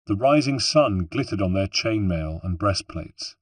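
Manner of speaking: ordinary delivery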